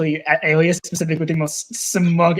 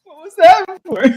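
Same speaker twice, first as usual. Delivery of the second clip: outraged squeal; 14 semitones higher, 6 dB louder than usual. Acted. Mock outrage in play.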